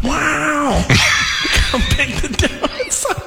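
laughing into a big echo; echoey reverb. Acted. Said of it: "Why it's so echoey in here".

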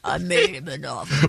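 low gangster growl; deep mob boss menace; mafia voice. Retold or imitated. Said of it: "that's also my mafia voice".

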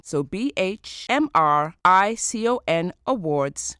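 slow letters "B H M R I C O N" each said apart; spelling it out. Real, not acted. Spells the address again.

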